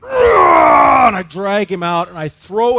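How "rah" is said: sudden loud roar at the dog; growled shout. Retold or imitated. Pastor roaring at the dog, loud shout. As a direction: bark out a wild Rah to scare him. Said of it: "and I'm like".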